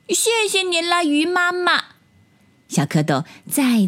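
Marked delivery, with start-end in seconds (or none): 0.1-1.8 put-on high-pitched childlike thanks; little tadpole. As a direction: thank them in a tiny high voice. Tadpoles thank mother fish. (小蝌蚪稚嫩地高声道谢)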